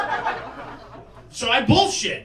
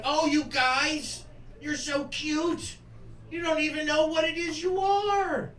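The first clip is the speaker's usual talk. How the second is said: higher, slow cooing; nosy woman cooing; 7 semitones higher, 3 dB quieter than usual. Retold or imitated. Nosy woman fawning over the two friends, slow and cooing. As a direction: coo over them like kittens. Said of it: "she's like".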